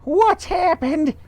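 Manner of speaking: wife alarmed at the upside down room; scream. Acted. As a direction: shriek in alarm. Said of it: "screamed Miss Twit".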